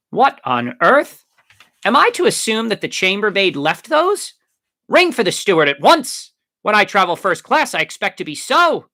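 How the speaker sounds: loud, high lordly outrage; pompous earl demanding service at once; indignant. A narrator's reading. Drunken earl indignant at untidy bed.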